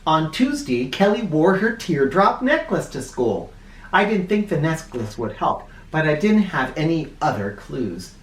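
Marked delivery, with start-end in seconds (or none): none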